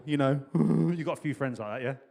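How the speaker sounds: ordinary delivery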